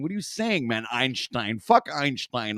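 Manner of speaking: normal talking voice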